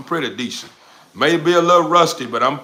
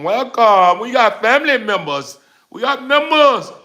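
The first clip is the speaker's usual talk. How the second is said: high, rising hype for a newcomer; excited welcome; 9 semitones higher, 4 dB louder than usual. Real, not acted. Streamer welcomes the new family member, high and hyped.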